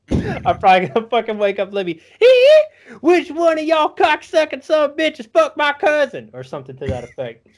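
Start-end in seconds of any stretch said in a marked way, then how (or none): 0.1-6.3 high, loud, laughing hillbilly rant. Retold or imitated. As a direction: bellow it like a crazed backwoods cousin. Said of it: "he's like".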